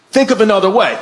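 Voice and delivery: forceful imitation of dad giving an order; insistent demand. Dad to principal refusing to negotiate, insistent demand. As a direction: demand it firmly, putting weight behind it.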